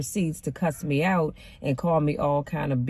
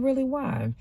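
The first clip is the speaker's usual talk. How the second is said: pastor's surprise; 10 semitones higher than usual. Retold or imitated. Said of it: "And he said".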